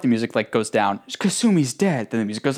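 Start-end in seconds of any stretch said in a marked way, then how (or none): 1.2-2.1 mock drama. Parodying dramatic beats.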